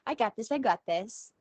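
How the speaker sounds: high-pitched, brushing-it-off know-it-all; mock overconfidence. Speaker voicing listener's thought, mock overconfident dismissal. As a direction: wave it away as already known. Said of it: "how often do you think".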